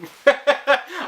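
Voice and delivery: high rising laugh. Real, not acted. Laughs at own story.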